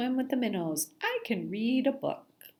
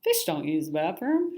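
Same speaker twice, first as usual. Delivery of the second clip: ordinary delivery, nothing marked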